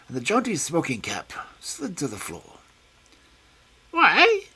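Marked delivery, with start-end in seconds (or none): 3.9-4.4 very high, loud sudden question; shrill alarmed man; high startled why. A narrator's reading. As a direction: jump at the suggestion. Smith startled.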